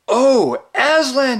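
high, loud cry of joy; leaping up high, calling out; excited cry. A narrator's reading. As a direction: cry out his name eagerly. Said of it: "he cried".

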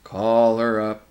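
slow, loud, menacing command; heavy, drawn-out creature voice; gray, terrible voice. A narrator's reading. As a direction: intone it slowly like a dreadful creature. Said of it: "said the gray voice".

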